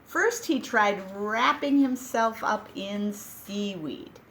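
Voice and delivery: ordinary delivery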